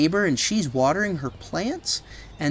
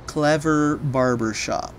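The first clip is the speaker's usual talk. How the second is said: usual speaking voice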